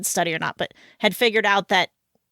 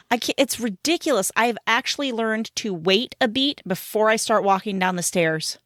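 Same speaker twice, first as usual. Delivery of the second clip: usual speaking voice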